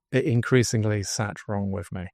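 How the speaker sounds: ordinary talk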